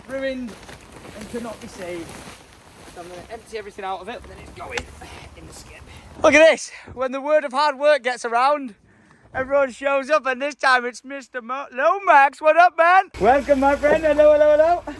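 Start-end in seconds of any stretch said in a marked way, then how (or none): 6.2-14.9 high and loud greeting; loud higher hello hello hello; excited welcome. Real, not acted. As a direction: cheer a friend's arrival. Friend arrives with machinery.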